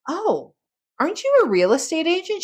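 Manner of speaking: high, quick, delighted surprise; asking the neighbor, high and quick with delighted surprise; surprised realization. Retold or imitated. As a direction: suddenly realize she's exactly who you need. Retelling sudden realization to neighbor.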